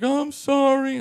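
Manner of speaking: high-pitched mock wail; mimicked whiny apology. Arrested woman apologizes to officer, mimicked high whine. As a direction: apologize high in her imitated voice.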